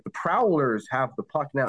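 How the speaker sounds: slow, deliberate "Prowlers" with a full ow; over-careful pronunciation. Retold or imitated. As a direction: carefully enunciate "Prowlers" in a mock game call. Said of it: "I had to make a conscious en".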